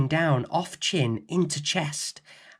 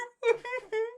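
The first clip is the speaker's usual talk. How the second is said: high wailing sob; giant sob; 4 dB quieter than usual. A narrator's reading. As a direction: let out a big wail. Giant sobbing.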